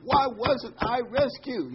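high, slow indignant demand; hammed-up angry preacher; mad complaint. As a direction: protest to heaven, slowly. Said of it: "he's mad, mad, mad".

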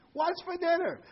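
high-pitched holler; acted yell. Husband tests his wife's hearing from closer up, high mock yell. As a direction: call it out high, testing her hearing.

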